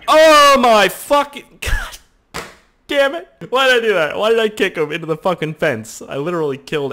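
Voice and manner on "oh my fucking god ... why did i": frustrated yell. Real, not acted. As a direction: yell it in real exasperation. Exasperated outburst at game mishap.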